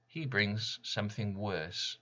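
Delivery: ordinary delivery, nothing marked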